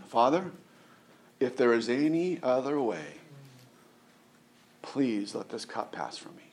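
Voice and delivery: soft, halting plea with long pauses; hushed pleading prayer. Acted. Preacher voicing Jesus praying in the garden, hushed plea.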